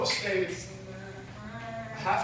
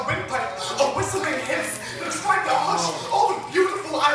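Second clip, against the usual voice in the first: building to a loud, higher-pitched outpouring; impassioned slam poetry; 4 semitones higher, 4 dB louder than usual. Acted. Poet accusing the mentor who silenced them, impassioned spoken-word climax. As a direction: let the poem surge, louder and higher to the peak.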